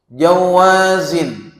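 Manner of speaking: Jawazin stretched very slowly, high and loud; emphasized Arabic word. Acted. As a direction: hold out Jawazin and land it hard. Preacher stresses the hadith word.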